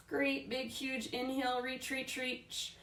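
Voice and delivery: high, soft voice, lifted as if on an in-breath; cueing a big inhale. Real, not acted. Yoga teacher cueing a full-body stretch on the inhale, high and soft.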